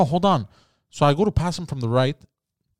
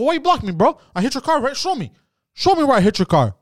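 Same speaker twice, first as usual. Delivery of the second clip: much higher, challenging; retold angry demand; 10 semitones higher, 4 dB louder than usual. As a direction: demand proof, heated. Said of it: "I go".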